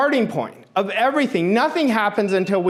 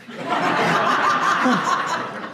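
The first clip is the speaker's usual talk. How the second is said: breathy real laugh at himself; 6 semitones higher than usual. Real, not acted. Father laughs while telling kids about surf fails. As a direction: laughs at his own failing.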